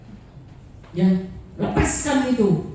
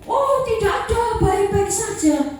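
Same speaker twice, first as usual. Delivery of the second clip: high sing-song mock denial, the congregation pretending innocence; mock innocent denial; 9 semitones higher than usual. Preacher mocks the congregation claiming nobody watches, high sing-song. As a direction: sing it up high like a guilty person saying no.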